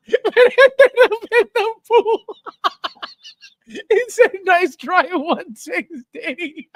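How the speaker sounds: high, squealing laughter through the words; helpless laughing. Real, not acted. Streamer reads a joke about the pool, cracking up. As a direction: barely get the words out for laughing.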